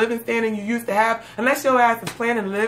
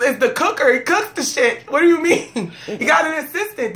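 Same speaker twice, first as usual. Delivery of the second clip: raised, animated comic rant; higher, insistent, hammed-up justification; mock-indignant defense; 4 semitones higher, 5 dB louder than usual. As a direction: argue it loudly, building to a proud punchline. Mock-defending the drug dealer comparison.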